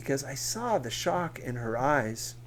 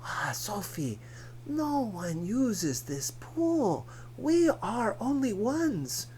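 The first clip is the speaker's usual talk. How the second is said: put-on Dika's accented voice; 8 semitones higher than usual. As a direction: coax her in a thick foreign accent. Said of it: "Dika reasoned".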